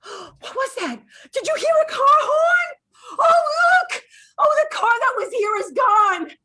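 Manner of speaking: high, loud alarmed exclamations; startled cry. Acted. Andrea crying out to Troy about the car, loud and high. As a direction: cry out at the sudden car horn.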